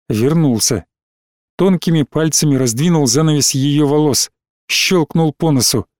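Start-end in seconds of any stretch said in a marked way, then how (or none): none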